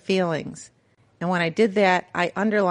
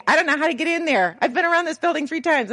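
higher, frantic voice of a woman lost in a parking lot; 8 semitones higher, faster than usual. Retold or imitated. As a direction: play her own panicky phone call, pitched up. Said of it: "I'm like this mad woman".

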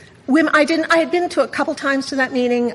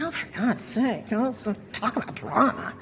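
muttered sarcastic aside, much softer; 9 dB quieter than usual. Retold or imitated. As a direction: roll your eyes under your breath. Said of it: "And I'm like".

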